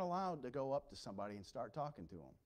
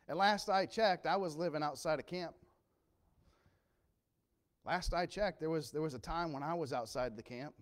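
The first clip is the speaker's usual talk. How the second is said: loud preaching refrain; 3 semitones higher, 8 dB louder than usual. Acted.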